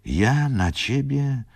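high, drawn-out question; affectionate disbelief. A narrator's reading. Rzecki denies being angry at Wokulski, affectionate disbelief. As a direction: stretch it out high in fond protest. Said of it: "wpatrując się w niego z przywiązaniem".

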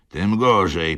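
loud, sharp interruption; bass cutting in. A narrator's reading. Bass diner interrupting the defender, loud and sharp. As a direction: cut him off hard. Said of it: "przerwał bas".

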